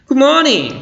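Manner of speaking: very high, loud, lively greeting; spirited good morning. Shown as a demonstration. Teacher modelling a morning greeting for students, spirited. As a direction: call out good morning with enthusiasm. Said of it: "ada semangat ketika kita berbicara".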